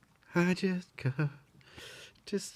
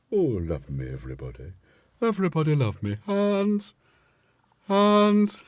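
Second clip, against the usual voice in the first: a light comic cat voice; Peter Sellers voice; 4 semitones higher than usual. Retold or imitated. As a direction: play the needy cat with a hint of comic character voice. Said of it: "That went Peter Sellers".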